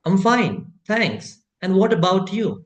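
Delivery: "I'm fine, thanks, and what about you" linked in one flowing phrase. Shown as a demonstration. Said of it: "अब इसको एक बार में मैं बोलने जा रहा हूँ".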